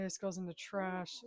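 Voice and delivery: ordinary delivery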